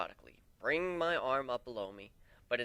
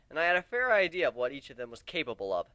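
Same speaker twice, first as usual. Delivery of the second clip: usual speaking voice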